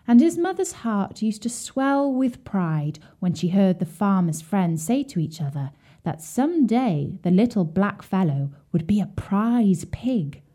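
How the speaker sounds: ordinary delivery, nothing marked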